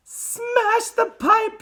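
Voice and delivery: very high, drawn-out yell; slow, piercing motherly cry; Missus Gloop. A narrator's reading. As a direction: screech a frantic order. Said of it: "yelled Missus Gloop".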